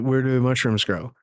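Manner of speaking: normal talking voice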